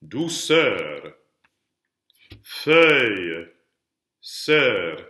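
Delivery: "Douceur, feuille, sœur" one by one, each ending drawn out; isolated diction-drill "Douceur, feuille, sœur" with swelling vowels; last-syllable emphasis. Shown as a demonstration. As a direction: lean on the end of "Douceur", then model "feuille" and "sœur" for repetition. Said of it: "putting all that emphasis on the last syllable".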